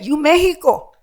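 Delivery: put-on loud, high Vietnamese-accented exclamation; imitated Vietnamese English. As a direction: mimic excited Vietnamese waiters calling out. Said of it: "That's the way they said it".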